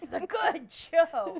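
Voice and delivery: loud, slow gushing with a laugh; excited gush. Real, not acted. Fan gushing over her favorite anime, excited and laughing. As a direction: gush about the show you love. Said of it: "she's getting excited".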